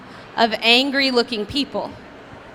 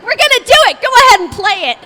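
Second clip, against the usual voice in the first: higher, louder gleeful command; mock shout; 10 semitones higher, 8 dB louder, faster than usual. Pushing castmates to sing, gleeful shout. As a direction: shout it to rally everyone. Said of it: "I'm like".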